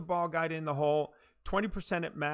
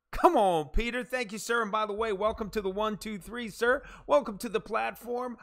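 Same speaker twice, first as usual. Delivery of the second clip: raised, punchy hype-man greeting; excited welcome; 5 semitones higher, 4 dB louder than usual. Real, not acted. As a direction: greet arriving viewers with pumped-up energy. Hyping new arrivals on stream.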